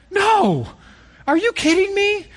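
high incredulous "No! Are you kidding me?"; shocked protest. Retold or imitated. Preacher to his kids who want to leave, high shocked disbelief. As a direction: act stunned that they want to leave. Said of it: "I'm like".

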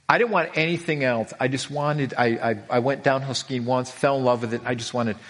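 ordinary talk

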